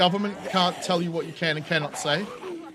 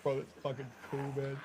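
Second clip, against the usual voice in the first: quiet stoner awe; 4 semitones lower, 14 dB quieter than usual. Retold or imitated. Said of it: "I'm like".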